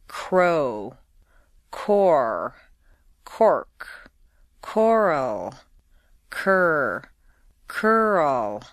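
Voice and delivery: each R pulled back deep into the throat; clear, careful R practice words; R drill words. Shown as a demonstration. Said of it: "Repeat after me".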